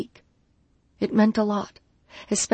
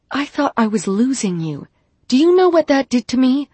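loud, high, anguished; emotional outburst; 7 semitones higher, 6 dB louder than usual. A narrator's reading. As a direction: pour out the fear. He pleads emotionally.

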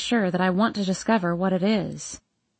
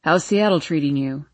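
father's voice; 5 semitones lower than usual. A narrator's reading. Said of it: "my father asks".

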